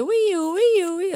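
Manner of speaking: high wailing siren; toddler's siren imitation. Mum shows all her city child says, high siren. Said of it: "det enda hon säger".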